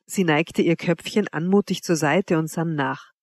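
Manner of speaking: plain reading voice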